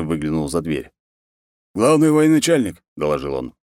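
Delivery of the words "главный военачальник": high, loud announcing call; formal announcement. Acted. Guard announces the war chief to the khan, high loud announcement. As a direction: call out a visitor's arrival.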